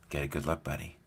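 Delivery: low, soft, dry aside; imitating a grandfather's quiet jibe; quiet teasing grandfather. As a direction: say it low and under your breath.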